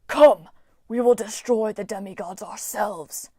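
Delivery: loud call, then an otherworldly vow; commanding armored brute. Acted. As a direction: order a comrade to attack. Wolfhead rallying Lionhead.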